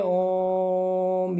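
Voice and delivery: ओम with the vowel held long as a pluta vowel; prolonged Om. Shown as a demonstration. Teacher giving Om as a pluta example, drawn out. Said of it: "ये प्लुत स्वर होता है".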